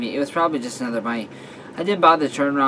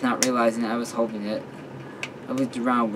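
usual speaking voice

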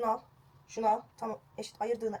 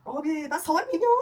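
gasp; 5 semitones higher, 5 dB louder than usual. Real, not acted. Shock at cutting too much.

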